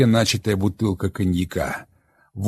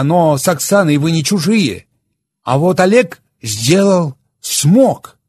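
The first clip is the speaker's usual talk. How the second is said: stern wife, higher and louder; Olga Viktorovna, reproachful; 8 semitones higher, 7 dB louder than usual. Acted. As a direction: scold the husband with contempt.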